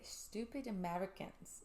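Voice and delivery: slow, soft, tentative Scottish accent; attempted Scottish accent. Shown as a demonstration. Host attempting it on co-host's dare, shaky Scottish accent. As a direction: try a Scottish accent, very unsure of it. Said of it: "let me mentally put myself there".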